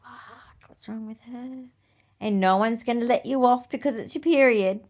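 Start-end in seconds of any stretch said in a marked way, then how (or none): 0.0-1.6 hushed, whispered behind her back; whispered gossip. Retold or imitated. Classmates whispering about a moody girl on her period. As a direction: mutter it like catty gossip. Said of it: "everyone around you is gonna go".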